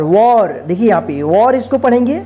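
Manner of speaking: ordinary delivery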